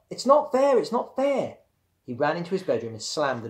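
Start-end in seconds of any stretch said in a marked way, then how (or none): 0.1-1.6 high, loud repeated protest; childish tantrum. A narrator's reading. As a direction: wail the grievance in protest. Tantrum continues at mother.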